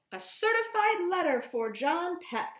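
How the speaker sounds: ordinary delivery, nothing marked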